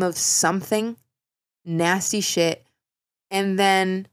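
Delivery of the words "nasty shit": ordinary talk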